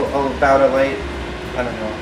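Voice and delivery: usual speaking voice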